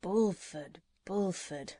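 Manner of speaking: softly muttered to himself, puzzled. A narrator's reading. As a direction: mumble the name under your breath. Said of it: "muttered the doctor".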